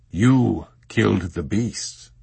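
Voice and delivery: slow, gently regretful; an old abbot gently breaking hard news; almost regretful. A narrator's reading. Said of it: "almost regretfully".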